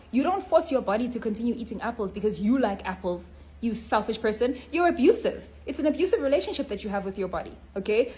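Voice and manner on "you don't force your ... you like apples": ordinary talk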